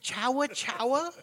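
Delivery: high, loud disbelief at chawa chawa; mock the silly word chawa in outrage; appalled at the movie sound chawa chawa; incredulous. Real, not acted. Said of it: "That's what the fuck y'all decided on?".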